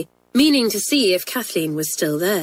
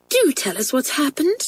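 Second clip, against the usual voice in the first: higher-pitched urgent plea; crying out; 7 semitones higher than usual. Acted. Twin begs friend to confide, crying out. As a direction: urge her on, voice lifted. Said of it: "cried Isabel".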